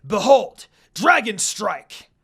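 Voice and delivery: commanding attack call; echoing yell. A narrator's reading. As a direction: boom it out slowly like a decree. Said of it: "yelled in an echoing voice".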